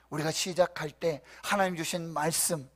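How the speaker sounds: ordinary talk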